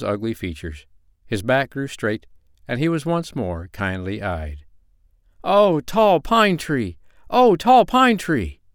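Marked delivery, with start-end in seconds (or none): 5.4-8.6 high, loud, drawn-out rejoicing; joyful cry. A narrator's reading. Freed little man hails his pine tree, crying out in joy. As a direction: call out slowly and jubilantly. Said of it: "he cried in joy".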